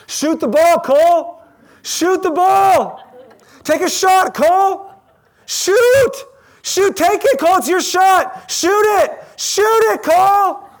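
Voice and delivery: frantic high shout from the stands; yelling. Retold or imitated. Dad yelling at his son to shoot, loud and high. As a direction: yell it like a dad at the court. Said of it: "So I just start yelling".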